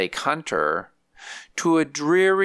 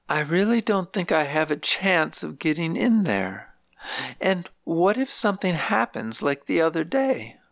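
lightly voiced elderly grandmother, pitched higher; grandmother's voice; 6 semitones higher than usual. Grandmother telling visiting family about nursing home life, higher lightly voiced. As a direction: lift the voice for an old woman complaining gently.

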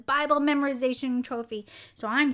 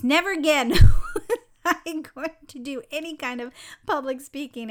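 laughing; 3 semitones higher, 7 dB louder than usual. Real, not acted. Laughing at her childhood vow.

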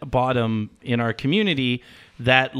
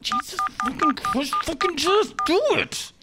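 exasperated; 14 semitones higher than usual. Acted. Caller loses patience.

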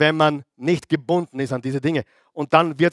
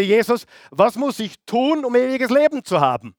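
put-on rich young man; 7 semitones higher than usual. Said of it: "Der reiche Jüngling kam zu Jesus und sagte".

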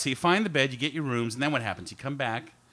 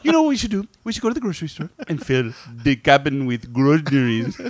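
mock Indian accent; 2 semitones lower than usual. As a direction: put on an exaggerated Indian accent. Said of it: "Now he's Indian".

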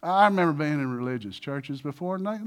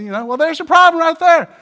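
ordinary delivery

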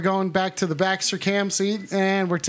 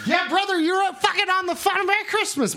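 much higher pumped bro greeting; hyped-up bro; 9 semitones higher than usual. Acted. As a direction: hype the caller up like a bro.

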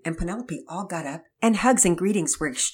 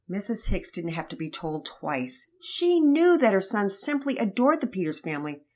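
plain reading voice

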